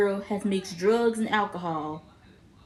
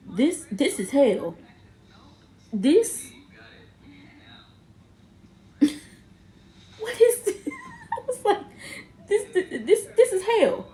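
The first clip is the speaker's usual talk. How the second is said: laughing disbelief; 10 semitones higher than usual. Real, not acted. Her own reaction, laughing.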